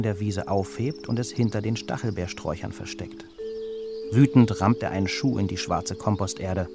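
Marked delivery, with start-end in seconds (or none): none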